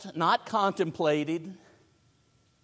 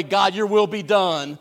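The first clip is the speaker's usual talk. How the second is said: pouting; 3 semitones higher, 7 dB louder than usual. Retold or imitated. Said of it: "in a pouting type of way".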